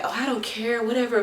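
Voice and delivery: high, airy mock indifference; dismissive. Retells pretending not to care.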